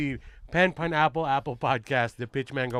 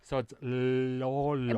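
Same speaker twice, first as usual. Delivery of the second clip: Filipino accent; much slower than usual. Shown as a demonstration. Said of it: "a good Filipino accent".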